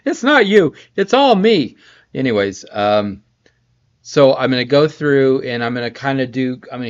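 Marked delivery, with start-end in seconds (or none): 0.1-1.8 high, loud hammed-up apology; mock dramatic breakup. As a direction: overplay it like a soap breakup. Repeats the joke, higher and louder.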